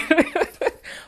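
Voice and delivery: sudden high giggle; laughing at her own taunt. Real, not acted. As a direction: break into a loud high laugh.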